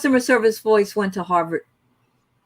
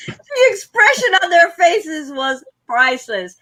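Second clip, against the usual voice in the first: high-pitched, laughing delivery; helpless laughter raising the voice; 8 semitones higher, slower than usual. Real, not acted. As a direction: talk through real laughter. Telling a funny story amid laughter.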